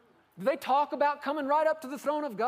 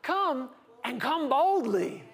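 God insisting; 4 semitones higher than usual. Retold or imitated. God waves off the grovellers, high and insistent. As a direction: wave off the grovelling, high and urgent. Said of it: "He said".

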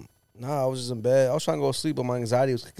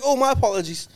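1 mock posh apology; 11 semitones higher, 4 dB louder than usual. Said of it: "He's like".

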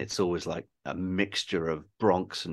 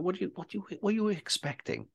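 high-pitched mock incredulity; incredulous retort; 11 semitones higher, 6 dB quieter than usual. Filmmaker answering a grudging critic, mock incredulous. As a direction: throw it back at a critic, pitch jumping up.

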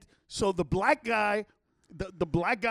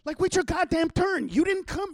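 retold rebuke, pitched up; exasperated scolding; 8 semitones higher than usual. Bystander telling daughter to wait her turn, exasperated scolding. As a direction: tell her off, worked up.

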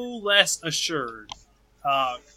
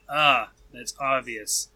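ordinary delivery

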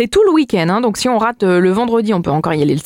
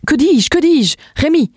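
hammy exclamation; 6 semitones higher, slower than usual. Acted. Hamming up a wordplay.

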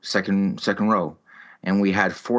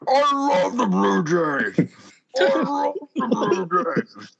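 much higher, slow, drawn-out dopey mimicry; mocking the woman's voice; 11 semitones higher, much slower than usual. Mocking the smelly woman beside him with a slow, much higher voice. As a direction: ham up a slow, high, dim-witted drone. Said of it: "spoke like this".

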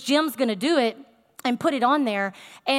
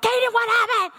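high-pitched panicked parent yelp; re-enacted frantic demand; freaking out; 10 semitones higher, 4 dB louder, faster than usual. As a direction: call out in alarm, voice leaping up. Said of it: "it's like freaking out".

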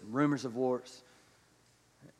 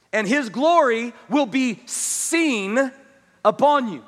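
higher, louder fervent preaching to the congregation; impassioned preaching; 11 semitones higher, 12 dB louder than usual. Acted. Preacher pressing the church, louder and higher, fervent. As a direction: preach it out, full force, pleading.

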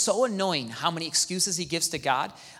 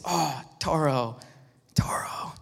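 tender call; 4 semitones lower, 4 dB quieter, slower than usual. Retold or imitated. Fondly calling the family dog.

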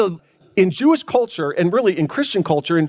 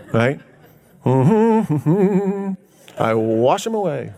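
mock smug claimant; much slower than usual. Mocking a self-made forgiver.

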